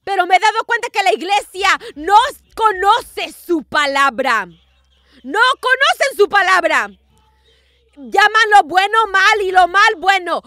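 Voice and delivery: loud, high fervent preaching; preaching at full force. Acted. Pastor warns the church, in loud fervent preaching. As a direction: rise to the climax and call it out.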